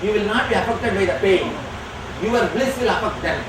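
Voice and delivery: ordinary talk, nothing marked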